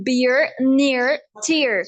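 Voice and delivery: American "beer, near, tear" with sounded R; crisp single words, American R; American accent. Shown as a demonstration. Teacher lists words for contrast, American accent. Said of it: "British में convert करूं".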